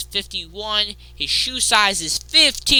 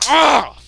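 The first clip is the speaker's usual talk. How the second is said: frustrated groan; 6 semitones higher, 7 dB louder than usual. Real, not acted. Frustrated outburst.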